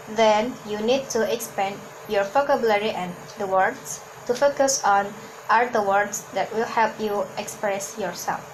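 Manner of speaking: ordinary delivery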